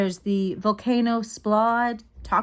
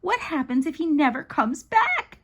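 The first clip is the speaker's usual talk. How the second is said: higher-pitched anxious question; child fretting, pitch climbing; fretful kid voice; worried little sister; 6 semitones higher than usual. Acted. Worried sister asks about dad.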